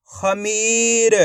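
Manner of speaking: "खमीर" very slowly as one word; slow teaching read of "खमीर"; blended, drawn-out word. Shown as a demonstration. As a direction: hold the ee in "खमीर". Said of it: "आपस में मिला के पढ़ेंगे".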